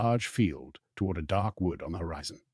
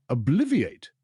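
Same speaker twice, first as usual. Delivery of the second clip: a high, louder snap of the spell; sharp spell; 7 semitones higher, 5 dB louder, slower than usual. Acted. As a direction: cast it crisply. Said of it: "he said sharply".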